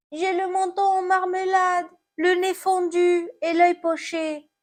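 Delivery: high, plaintive apple voice; hurt apple. Acted. Fallen apple answers about its injuries, high lament.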